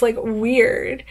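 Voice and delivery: ordinary talk, nothing marked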